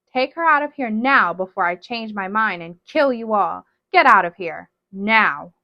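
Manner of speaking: loud furious order; angry threat. Acted. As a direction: snarl the threat. Witch threatens to kill them.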